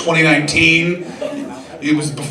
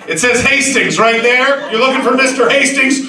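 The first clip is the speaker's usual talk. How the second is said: bossy grandfather; 9 semitones higher than usual. Retold or imitated. Grandfather bluffing the driver.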